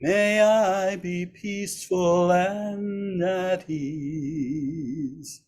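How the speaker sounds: normal talking voice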